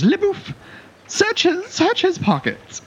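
very high, prissy command; shrill-pitched dandy boss; fop giving orders. Acted. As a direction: snap fingers and order the lackey, high and fussy. Fop guard orders his henchman.

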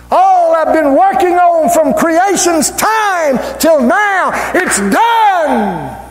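high, sustained preacher's cry; ringing, high-pitched proclamation; acted triumphant shout. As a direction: shout it out as God proclaiming victory. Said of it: "he shouts".

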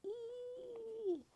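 long, very high squeal; baby dragon's piercing "eee"; high-pitched cry. A narrator's reading. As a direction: hold one thin, high screech. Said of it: "He held out a high-pitched cry".

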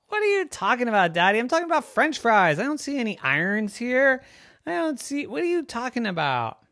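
小孩子连珠炮似的困惑追问; confused little girl. A narrator's reading. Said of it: "And Daddy said".

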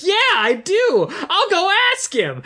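put-on high, drawn-out overeager kid's answer; over-eager [name]. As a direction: put on a squeaky, unnaturally keen voice. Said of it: "no one talks like that, [name]".

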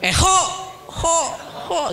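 put-on mother hollering back to her child. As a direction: holler across the field. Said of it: "teriak-teriak gitu".

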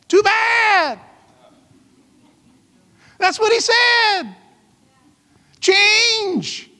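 high, shouted retort; high-pitched rebuke. Real, not acted. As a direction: cry out each short rebuke to the complainers. Rebukes the complainers he voiced.

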